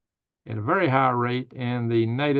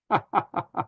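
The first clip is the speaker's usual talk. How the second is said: breathy laugh; 7 semitones higher than usual. Real, not acted. Small laugh after troll joke.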